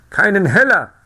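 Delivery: high, loud, excited insisting; agitated town crier. A narrator's reading. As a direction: press the point frantically. (aufgeregter Ausrufer, hoch und laut)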